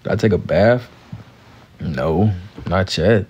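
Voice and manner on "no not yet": normal talking voice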